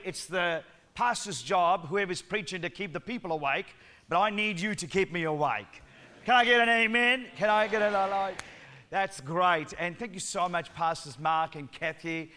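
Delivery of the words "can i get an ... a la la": ordinary delivery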